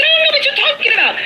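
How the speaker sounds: high, fast, mock-innocent denial; imitating flustered denial. Woman telling angry [name] she knows nothing, high and fast. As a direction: rattle it off high like a nervous woman playing dumb. Woman denies knowing, retold quote.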